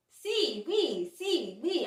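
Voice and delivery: alternating "Si" and "oui" with a bouncing pitch; Spanish and French mix. Shown as a demonstration. Recalling her mix-ups with the taxi driver, bouncing between Spanish and French. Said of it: "I kept saying, uh".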